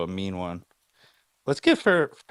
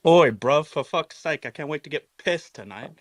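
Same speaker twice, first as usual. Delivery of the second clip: put-on British accent, raised pitch, loud opening; 4 semitones higher, 5 dB louder than usual. Shown as a demonstration. American host gives a taste on request, mock British accent. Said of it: "your version of a British accent".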